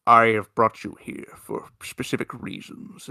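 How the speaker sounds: lightly deepened solemn cosmic being; a hint of a grave cosmic being; grave Beyonder voice. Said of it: "this very deep gravitas voice".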